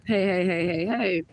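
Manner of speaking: usual speaking voice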